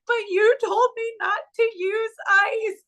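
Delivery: high, loud-edged tearful protest; mock sobbing complaint; imitating crying niece. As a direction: wail it like a hurt child. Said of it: "she's crying and she's hurt".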